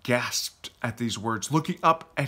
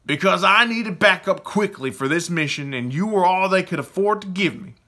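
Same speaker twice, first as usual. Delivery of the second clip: louder, higher, cutting retort; raised-voice, emphatic comeback; forceful interruption; 6 semitones higher, 6 dB louder than usual. A narrator's reading. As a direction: butt in firmly to put someone down. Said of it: "Jake Orion interrupted".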